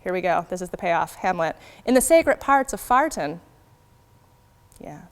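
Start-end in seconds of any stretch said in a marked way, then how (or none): 1.9-3.4 "Farton" for fortune, ar vowel stressed; original pronunciation. Shown as a demonstration. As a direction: punch the pun, "Farton" with open ar. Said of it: "fortune sounds more like farton in O P".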